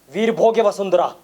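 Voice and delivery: firm, loud lead-in for the crowd; leading a slogan. Acted. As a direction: give out the motto for all to repeat. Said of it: "मेरे साथ एक आवाज में बोलिए". (बुलंद आवाज़ में नारे की शुरुआत)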